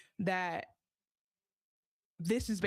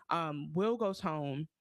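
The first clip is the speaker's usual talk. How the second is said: ordinary talk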